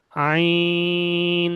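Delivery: long "আইন" voiced from the middle of the throat. Shown as a demonstration. Teacher demonstrating throat letter, slowly held. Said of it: "উচ্চারণ হবে পুরো গলার মাঝখান দিয়ে".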